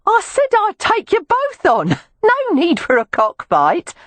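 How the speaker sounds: Cockney woman mocking the cockfight; Cockney teasing. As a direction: tease the fighters in a Cockney lilt. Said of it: "called out in a Cockney accent".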